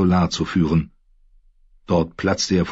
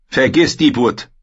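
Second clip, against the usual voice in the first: put-on higher, louder young lord ranting; raised, frustrated voice of an embattled lord; Theon, angry and anxious; 7 semitones higher, 5 dB louder than usual. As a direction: build up the grievance, then demand. Theon dismisses.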